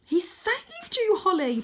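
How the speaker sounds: high, slow, gushing; young cat Tam, thrilled; bubbling Tam. Acted. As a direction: gush with delight. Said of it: "bubbled Tam".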